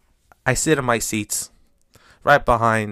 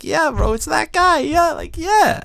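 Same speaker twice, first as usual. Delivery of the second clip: mock Buck Showalter. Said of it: "Buck Showalter was like".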